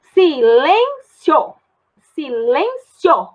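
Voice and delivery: silêncio drawn out very slowly; slow demo. Shown as a demonstration. Teacher slowly showing silêncio to the class. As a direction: stretch silêncio, keep sio together. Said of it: "Sio ficou junto".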